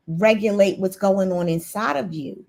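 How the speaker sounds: usual speaking voice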